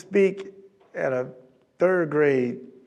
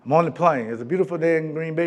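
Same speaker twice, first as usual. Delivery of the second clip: ordinary delivery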